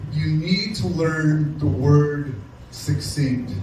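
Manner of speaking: very slow, each word laid down; slow emphatic advice. Real, not acted. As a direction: spell it out slowly to the ladies. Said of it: "take heed to this, please".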